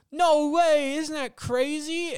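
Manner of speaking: high, loud fake amazement, slowing at the end; mock talk-show host gushing; fake amazed host. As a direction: ham it up for the studio crowd. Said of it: "you're looking at the crowd going".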